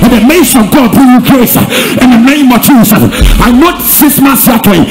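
loud, driving revival-preacher shout; full-force preaching. Real, not acted. Preacher calling God's mercy down on the congregation, full-force shouting. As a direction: thunder out blessings, pushing hard on every phrase.

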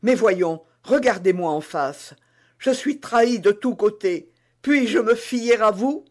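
the betrayed queen demands the truth; anxious queen. Acted. La reine interroge la servante. (reine inquiète et pressante)

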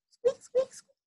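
very high squeaks; high mouse squeaking. Pretend. Mouse goodnight sound.

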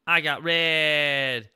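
very slow, vowels held long; drawn-out announcement. Pretend. Streamer showing off drinks, long drawn-out announcement. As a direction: stretch RED right out.

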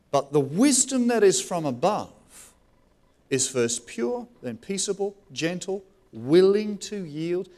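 slow, deliberate reading of the verse; measured scripture recital. Acted. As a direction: lay each quality down slowly. Reads scripture slowly.

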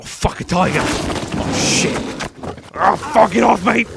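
loud, high startled shouts; alarmed yelling. Real, not acted. Player attacked by a tiger in the game, panicked yelling. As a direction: yell out as if suddenly attacked.

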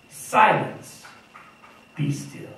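firm, stern command to the storm, then dropping off; a stern master's command; commanding rebuke. Retold or imitated. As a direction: order the sea to be silent. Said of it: "said to the water".

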